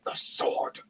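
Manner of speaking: urgent hissed whisper. A narrator's reading. Raistlin alerts Tanis to the blade, hissing. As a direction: hiss it as a sharp warning. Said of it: "he hissed".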